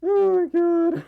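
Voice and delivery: high squealing laugh then slow gasp; shrieking laugh. Real, not acted.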